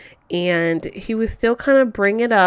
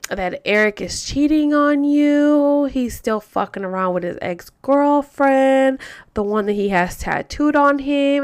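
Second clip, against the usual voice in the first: slow, drawn-out, held-note recital; mock letter-reading; 4 semitones higher than usual. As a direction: recite the accusation slowly, stretching the ends.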